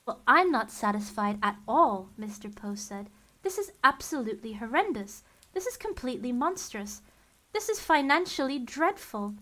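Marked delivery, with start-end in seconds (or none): 0.1-2.1 louder, outraged objection; indignant protest. Acted. Mister Poe objecting to the wedding, loud and indignant. 3.4-9.3 building indignant complaint, louder toward the end; rising outrage. Acted. Mister Poe protesting to Count Olaf, building indignation.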